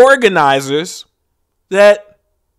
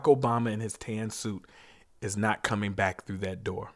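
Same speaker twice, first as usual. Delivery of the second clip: much softer and lower, grieving; hushed and somber; 9 semitones lower, 9 dB quieter than usual. Acted. Comic naming the dead to the crowd, hushed and grieving. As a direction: drop almost to a murmur, like reading names at a memorial. Somber list of victims' names.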